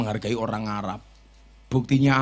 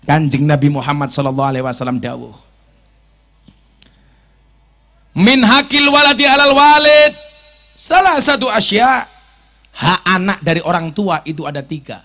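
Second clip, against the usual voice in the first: loud, high hadith recitation; preaching; 3 semitones higher, 13 dB louder than usual. Acted. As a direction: proclaim the hadith to the congregation.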